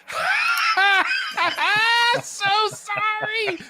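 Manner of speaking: high cackling mock apology; shrill-pitched gloating laugh, drawn out; mocking evil laugh. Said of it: "That's how he really said it".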